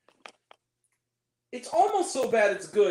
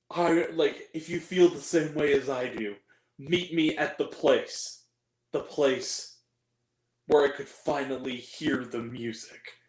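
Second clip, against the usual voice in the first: lightly voiced sappy leading man; mock romantic hero; 7 semitones lower than usual. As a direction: parody of a romantic confession. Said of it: "He says something like".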